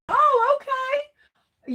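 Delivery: surprised. Real, not acted. Surprised the hunt was postponed.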